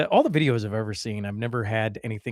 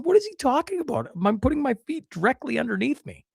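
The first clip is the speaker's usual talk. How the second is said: high, mock-indignant voice of the woman; lightly voiced annoyed woman, pitched well up; mocking the lady; 10 semitones higher than usual. Lady in front quoted.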